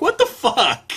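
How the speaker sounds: high, loud outburst of disbelief; real shocked disbelief. Real, not acted. Reader reacting to a shocking line, loud disbelief.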